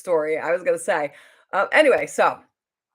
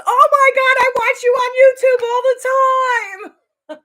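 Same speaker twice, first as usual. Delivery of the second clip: starstruck fan squeal; 10 dB louder than usual. Retold or imitated. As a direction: imitate a fan shrieking with recognition. Retold fan recognising the speaker.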